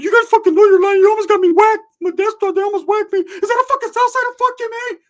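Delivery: very high, squeaky shouted rant; frantic cartoonish mock tirade; Ricky going crazy. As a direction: imitate a hothead losing it in falsetto. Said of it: "And just going crazy".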